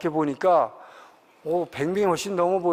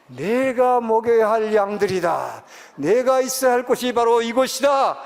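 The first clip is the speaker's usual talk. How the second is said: slow, loud, higher solemn proclamation of 내가 먹여야 할 양들이다; voice of the Lord; 6 semitones higher, 5 dB louder than usual. Retold or imitated. The Lord telling the preacher these are his sheep, booming and slow. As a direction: proclaim it as God, weighty and slow. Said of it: "주님께서 그때 그러시는 거예요".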